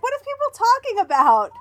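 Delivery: high-pitched confused wail; exaggerated baffled question; retold bafflement. Acting out own earlier bafflement.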